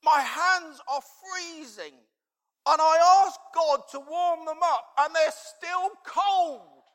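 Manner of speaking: put-on high, loud, slow, whiny boy close to tears; upset child. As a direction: plead like a frozen, frustrated kid. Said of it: "he's like".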